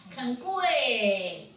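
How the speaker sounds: 跪 held long on a falling tune; drawn-out call. Shown as a demonstration. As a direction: stretch 跪 out like a ritual call. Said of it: "都拉长音". (拉长音喊“跪”)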